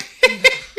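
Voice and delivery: boastful laugh. Acted. Barangay captain laughing boastfully.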